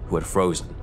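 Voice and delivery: ordinary talk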